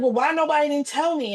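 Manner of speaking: retold hurt disbelief; upset disbelief. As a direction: ask why nobody told you, stunned. Retold upset question to sister.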